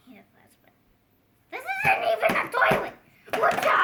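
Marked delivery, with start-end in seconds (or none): 1.5-3.8 high, loud, fast indignant yell; hammed-up shouted disbelief; outraged shout. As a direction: blow up at the fake toilet. Character outraged at fake toilet.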